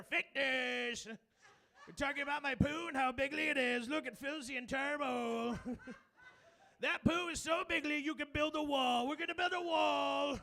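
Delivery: loud, laughing Trump boast; Trump impression. Son bragging about his poop like Trump, loud. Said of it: "like Donald Trump in a press conference".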